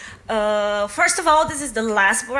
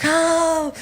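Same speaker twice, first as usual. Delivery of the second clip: mock feminist rally; 3 semitones higher, slower than usual. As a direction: ham up a ranting activist. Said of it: "very feminist and like".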